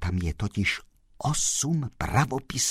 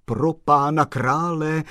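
loud, slow, high exclamation; raised-voice dismay; exclaimed; 7 semitones higher, 7 dB louder than usual. A narrator's reading. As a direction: cry out in consternation, drawing it out. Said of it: "zvolal pan Kolbaba".

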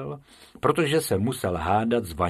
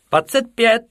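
much higher and louder cry of the number; calling out; 13 semitones higher, 9 dB louder than usual. A narrator's reading. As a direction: shout it out from the group. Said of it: "vykřikl jeden".

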